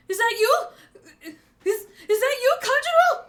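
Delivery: high, loud, stammering question; astonished recognition. Acted. Orochi recognising Kanjuro's shadow, astonished. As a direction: rise in amazed disbelief.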